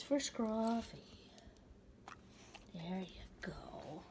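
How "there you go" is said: slow whispered "There you go"; gentle whisper. Real, not acted. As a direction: hush it as you give the treat. Handing treat to dog.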